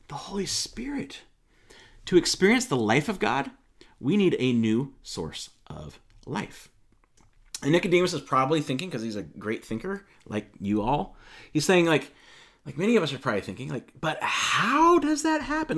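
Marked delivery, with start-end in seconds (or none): 0.1-1.2 dramatic stage whisper; hushed emphasis. Acted. Jesus telling Nicodemus what he needs, whispered. 14.0-15.8 high, drawn-out bewildered voice; puzzled question. Acted. Nicodemus wondering how new birth happens, puzzled.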